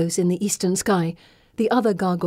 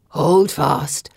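low, gravelly gargoyle voice; gravelly command; 5 semitones lower than usual. A narrator's reading. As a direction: give the order in a deep stone-creature rasp. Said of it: "commands in a gravelly voice".

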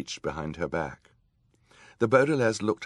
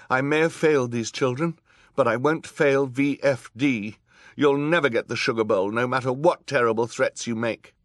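high, loud, indignant woman judge; defiant judge; 8 semitones higher, 9 dB louder than usual. A narrator's reading. Judge refusing to help the villain Olaf, high loud defiance. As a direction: refuse firmly, voice raised and pitched high.

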